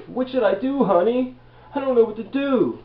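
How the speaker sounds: high, pleading mock whine to the wife; whiny helpless husband. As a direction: ham up a needy man begging his wife. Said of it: "You got the man going to the wife".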